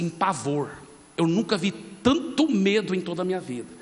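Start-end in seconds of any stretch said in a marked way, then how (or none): none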